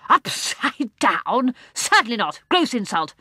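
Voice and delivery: put-on high, loud indignant old man; insulted gentleman protests to Jane; offended huff. A narrator's reading. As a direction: bluster with wounded pride. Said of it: "gave her an angry look".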